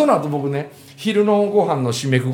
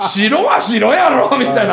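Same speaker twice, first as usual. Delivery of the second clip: laughing retold gripe; 7 semitones higher, 5 dB louder, slower than usual. Retelling a friend's annoyance.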